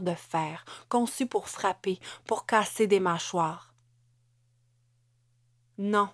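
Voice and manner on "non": a firm, cool refusal; cold reply. A narrator's reading. Wife answering husband's concern, coldly. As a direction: answer with understated coldness. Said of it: "répondit Amélie, froidement".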